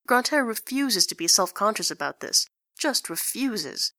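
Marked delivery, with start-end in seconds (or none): none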